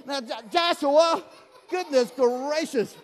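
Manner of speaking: slow words through laughter; chuckling exasperation; laughing. Real, not acted. Laughing at volunteer.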